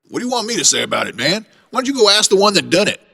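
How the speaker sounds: fast, rising, exasperated snap; irritated retort. Acted. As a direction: push back hard, fed up. Witness snaps at investigator.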